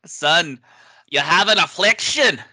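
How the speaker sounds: mock stern superior. Co-host plays officer addressing the nervous lieutenant, mock stern. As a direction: pronounce it like a mock-grave officer.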